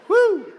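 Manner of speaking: high, loud whoop; whooping with a laugh. Real, not acted. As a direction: let out a whooping cheer.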